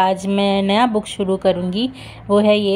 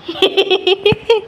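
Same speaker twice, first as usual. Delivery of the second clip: high squeaky laugh; 11 semitones higher than usual. Real, not acted. Laughing at dog.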